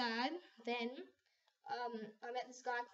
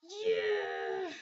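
excited cheer; 7 semitones higher, 8 dB louder than usual. Retold or imitated. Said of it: "I was like".

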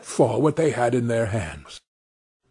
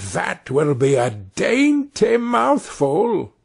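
slow, higher, relishing witch voice; greedy witch aside; 6 semitones higher, slower than usual. A narrator's reading. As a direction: lick your lips over the thought. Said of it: "she muttered to herself".

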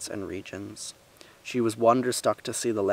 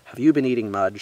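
loud, stern questioning; a mother's firm interrogation; 8 dB louder than usual. A narrator's reading. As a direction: question the child firmly, at raised volume. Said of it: "she repeated sternly".

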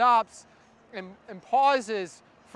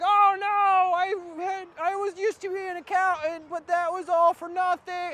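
mock screaming; 6 semitones higher than usual. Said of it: "screaming like".

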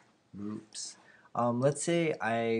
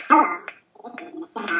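pitched way up like a chipmunk; sped-up squeaky chipmunk; 12 semitones higher, 13 dB louder than usual. Shown as a demonstration. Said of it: "now I sound like a chipmunk".

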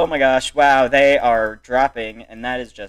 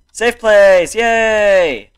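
loud, higher celebratory shout; happy cheer; 7 semitones higher, 9 dB louder, much slower than usual. Real, not acted. Player reaching a safe place, happy cheer. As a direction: cheer it out, stretching the last word.

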